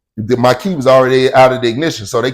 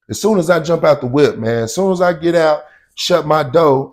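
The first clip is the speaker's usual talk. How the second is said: usual speaking voice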